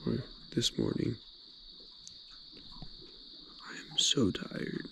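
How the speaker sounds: soft early morning whisper. Real, not acted. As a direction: whisper so no one wakes. 4am, quiet at the lake.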